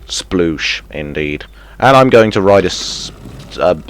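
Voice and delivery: ordinary talk, nothing marked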